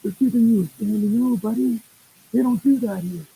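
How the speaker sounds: high, loud excited cheer; pumped-up coach urging his pitcher. Retold or imitated. Coach to pitcher on the mound, excited and loud.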